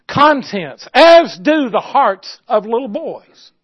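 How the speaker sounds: loud, slow preaching with long pauses. Real, not acted. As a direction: drive each phrase home from the pulpit. Preaches at full force.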